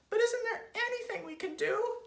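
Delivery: very high, loud desperate squeal; Mrs. Twit's pleading cry. A narrator's reading. Wife begging husband for a remedy, high cry. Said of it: "cried Missus Twit".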